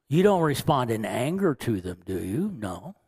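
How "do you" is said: usual speaking voice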